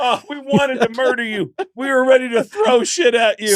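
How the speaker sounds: high-pitched imitated crowd outburst; relieved crowd voice. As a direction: vent like an audience let off the hook.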